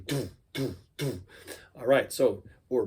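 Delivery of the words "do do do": soft, quick do beats with puckered lips; quick do kick. Shown as a demonstration. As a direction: quietly pop out quick do beats. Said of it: "puckering your lips".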